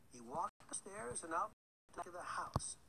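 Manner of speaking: ordinary delivery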